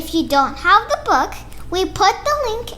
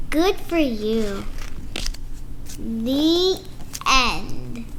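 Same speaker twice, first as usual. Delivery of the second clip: lively picture-book read-aloud with character voices; reading to children; slower than usual. Acted. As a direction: read it like story time for little kids.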